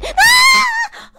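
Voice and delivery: very high, loud shriek; startled scream. Real, not acted. Streamer hit by a jump scare, screaming.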